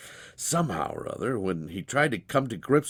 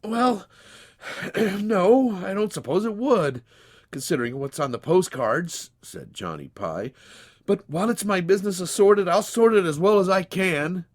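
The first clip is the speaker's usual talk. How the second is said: higher, louder anguished protest; in agony; 9 semitones higher, 6 dB louder than usual. Acted. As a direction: defend yourself in anguish. Johnny answers.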